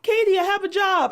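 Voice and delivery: high-pitched imitation of a proud husband; mock proud announcement. Husband comes home to tell wife, mock proud. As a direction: announce it high, like a kid with news. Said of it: "got home and told Katie".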